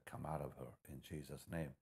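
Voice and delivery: softly and gently, casting out the demon; a hushed, calm rebuke; gentle command. Retold or imitated. Preacher retelling Catherine's calm rebuke of a demon, said gently. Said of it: "Catherine just very gently said".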